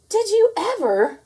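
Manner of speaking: put-on high, slow astonished old lady voice; gasping grandma. A narrator's reading. Grandma astonished at the duck, high slow gasp. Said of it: "Grandma gasped".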